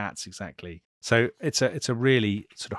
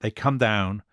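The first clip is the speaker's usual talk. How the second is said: ordinary delivery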